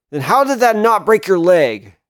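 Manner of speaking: high, loud disbelief; shocked. Acted. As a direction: blurt it out in shock. Shocked after failed kick.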